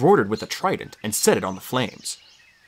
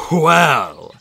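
sighing out the word Well in relief; a relieved old teacher sighing Well; 3 semitones higher, 6 dB louder than usual. A narrator's reading. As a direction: let Well out on a relieved breath. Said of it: "he sighed in obvious relief".

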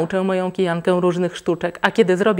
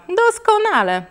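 doskonale repeated much higher and falling hard; mother's sarcastic doskonale, repeated much higher; 13 semitones higher than usual. Acted. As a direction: push the second doskonale up high, biting. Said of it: "powiedziała mama".

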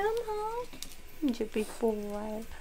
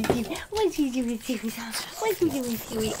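ordinary talk

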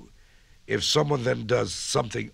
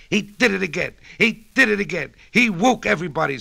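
higher, excited pig squeal; a pig complaining; 7 semitones higher, 4 dB louder than usual. A narrator's reading. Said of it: "squealed the pig in the pen".

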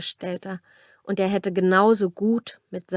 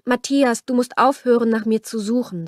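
Liliana, husky voice; 4 semitones higher, 5 dB louder than usual. A narrator's reading. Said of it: "sagte sie leise und ihre Stimme klang belegt".

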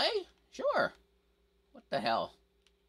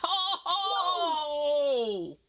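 anguished shout; 4 dB louder than usual. Real, not acted. Reaction to losing fight.

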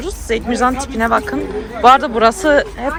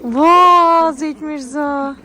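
drawn-out delight; 5 semitones higher, 5 dB louder, much slower than usual. Real, not acted. Marvels at child in snow.

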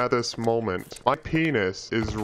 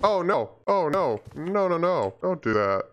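startled panic; 5 semitones higher than usual. Real, not acted. Monster spawns suddenly.

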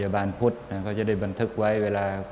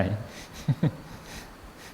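breathy quiet chuckle; soft laugh; 7 dB quieter than usual. Real, not acted. Laughter after the quip.

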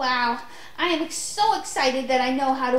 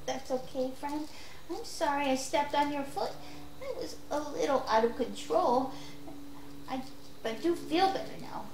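calm apology; 7 dB quieter than usual. Acted. Calmed down, apologizing back.

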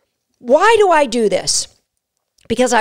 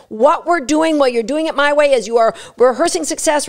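ordinary talk, nothing marked

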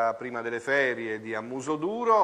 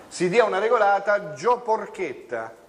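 mock stern rebuke; 6 semitones higher, 5 dB louder than usual. Co-host mock-scolds the guest.

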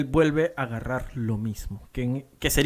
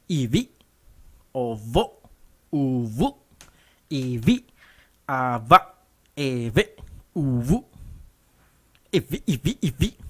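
forceful scratch syllables; slower than usual. Shown as a demonstration. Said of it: "vi, con fuerza".

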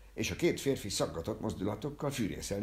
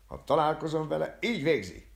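high, forceful vow; loud threat; 9 semitones higher, 7 dB louder than usual. Acted. Barber vowing revenge after his shot, loud threat. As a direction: threaten at full voice.

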